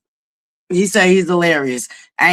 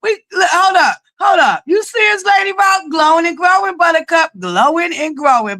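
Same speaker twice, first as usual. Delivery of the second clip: excited hype shout; 10 semitones higher than usual. Real, not acted. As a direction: hype up the crowd, high and punchy. Hyping up Buttercups, calling out.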